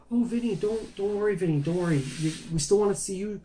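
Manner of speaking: high put-on voice, soothing away the worry; retold reassuring specialist. Specialist phones back to calm patient, imitated reassurance.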